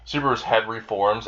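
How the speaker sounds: usual speaking voice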